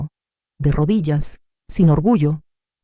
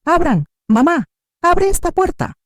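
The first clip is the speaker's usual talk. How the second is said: high, loud frantic cries at the door; desperate shouting; 4 dB louder than usual. A narrator's reading. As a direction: pound the door and yell for her mother. (gritos desesperados, agudos y fuertes)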